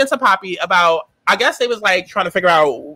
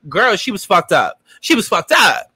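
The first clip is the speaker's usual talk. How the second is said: ordinary talk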